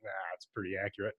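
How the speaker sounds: low, quick, soft aside; offhand dismissal. Retold or imitated. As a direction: toss it off quickly under your voice.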